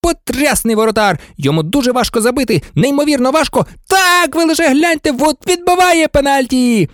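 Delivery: nervously cheering sportscaster; excited match commentator. Acted. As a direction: build to a thrilled call of the save.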